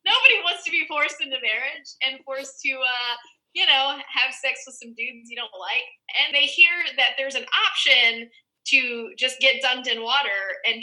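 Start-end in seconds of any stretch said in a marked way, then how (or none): none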